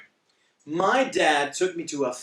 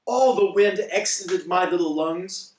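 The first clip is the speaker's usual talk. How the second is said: ordinary delivery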